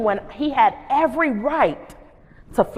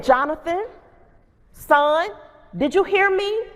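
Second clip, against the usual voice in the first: higher, pointed scolding with a neck roll; sassy scolding; 7 semitones higher than usual. Retold or imitated. Principal scolding the student Jonathan, higher and pointed. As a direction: call him out, daring him to answer.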